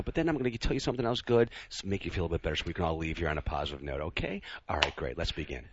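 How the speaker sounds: quick, lilting, patronizing review voice; mock teacher. Boss opening Terry's review with a compliment sandwich, mock teacher. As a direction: play a teacher gently giving feedback, pitch swooping up. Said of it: "Thanks, Teacher [name]".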